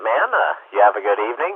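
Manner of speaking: ordinary talk, nothing marked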